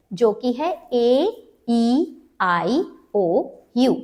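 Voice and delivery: ई, आई drawn out very slowly as letter names; long vowel names. Shown as a demonstration. Teacher showing long vowel sounds, slowly drawn out. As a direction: stretch each long vowel like saying the letter. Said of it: "long vowel sound".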